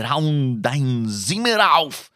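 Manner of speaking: slow, heavy German order; stern German command. Shown as a demonstration. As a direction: bark it out like a strict father. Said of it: "να είμαι σε φάση".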